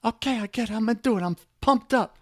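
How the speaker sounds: hammed-up keen churchgoer; eager listener quote. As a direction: play the keen man who has no clue how. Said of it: "And you're like".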